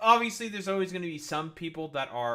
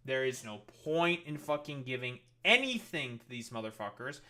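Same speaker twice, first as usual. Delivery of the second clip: ordinary delivery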